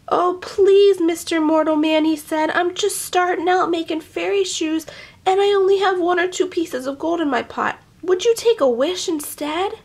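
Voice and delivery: high, wheedling little leprechaun voice; pleading leprechaun. Acted. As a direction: coax him like a sly little fairy.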